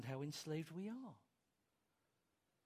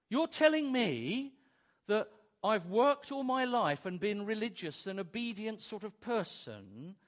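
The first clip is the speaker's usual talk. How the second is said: louder, higher, outraged complaint; indignant objector; 4 semitones higher, 7 dB louder than usual. Retold or imitated. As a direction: protest loudly that it's unfair.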